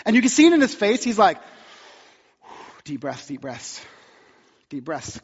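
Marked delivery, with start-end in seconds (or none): 2.4-5.2 soft, low, murmured to himself; calming deep breaths. Retold or imitated. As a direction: talk yourself down, hushed. Manager calming himself after suggestion.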